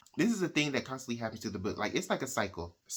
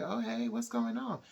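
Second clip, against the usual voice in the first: mock surprised greeting; 11 semitones higher than usual. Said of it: "And she's like".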